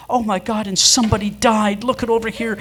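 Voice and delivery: high, loud horrified exclaiming; alarmed exclaiming. Retold or imitated. Neighbor's wife discovering the staged murder, exclaiming in high loud alarm.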